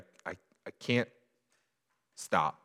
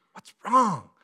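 put-on childlike plea; 9 dB louder than usual.

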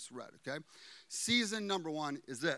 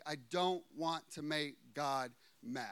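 usual speaking voice

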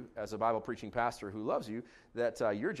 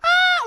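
shrill high screaming Ah! of a mussel; mock scream; 16 dB louder than usual. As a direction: shriek it like a tiny stabbed creature.

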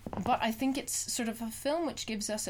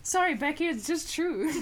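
higher, louder mock mum voice; impression of friend's mum; 6 semitones higher, 3 dB louder than usual. Teasing Becky with a put-on voice of her mum. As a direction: play her mum, cheerfully blunt. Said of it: "That's my impression of your mum".

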